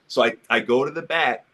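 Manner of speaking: ordinary talk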